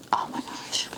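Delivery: soft whisper of delight; awed whisper. Real, not acted. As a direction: whisper it, taken aback by beauty. Awed at a pretty edition.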